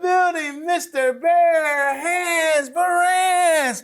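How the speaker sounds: high, drawn-out show-host shout; big announcer introduction. Acted. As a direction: announce the guest like a ringside presenter.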